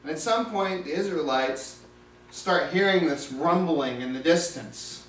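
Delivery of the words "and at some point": ordinary delivery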